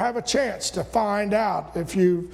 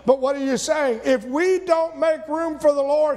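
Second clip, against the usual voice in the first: ordinary talk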